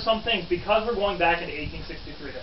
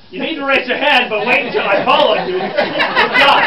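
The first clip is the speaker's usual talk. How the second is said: loud, climbing to a high exclamation; scold, then burst out exasperated; 6 semitones higher, 11 dB louder than usual. Acted. Reporter scolding a student who called out, exasperated.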